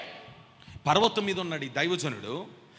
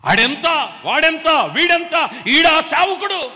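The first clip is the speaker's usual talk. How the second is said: scornful mocking; 7 semitones higher, 8 dB louder, slower than usual. Mocking youths belittling servants.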